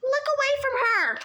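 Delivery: high, loud, fast shout of warning. A narrator's reading. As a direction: yell at them to look away. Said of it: "Annabeth shouted".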